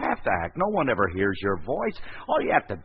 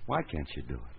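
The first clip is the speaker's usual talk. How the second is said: soft worried; 10 semitones lower, 11 dB quieter than usual. Real, not acted. Worried question to wife.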